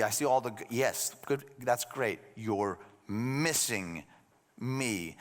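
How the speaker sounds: low, soft, tender plea with long pauses; gentle pleading. Acted. Jesus to Martha busy with good things, gently pleading. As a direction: plead quietly, letting each phrase sink in. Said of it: "I think he's pleading with her".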